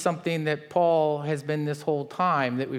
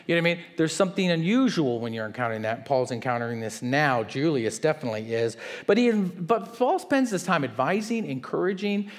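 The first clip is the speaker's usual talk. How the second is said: usual speaking voice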